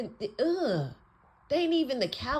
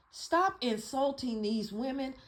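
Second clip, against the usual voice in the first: ordinary talk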